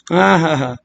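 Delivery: ordinary talk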